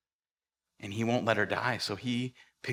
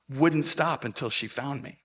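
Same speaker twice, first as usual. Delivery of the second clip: usual speaking voice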